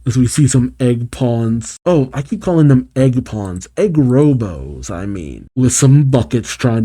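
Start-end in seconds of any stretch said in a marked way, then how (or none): none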